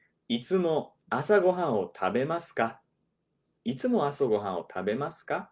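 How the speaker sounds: "いつも朝ごはんを食べますか?" at full speed; 普通の速さで; fast native Japanese. Shown as a demonstration. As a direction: rattle it off like a native speaker. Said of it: "let's get into the title here".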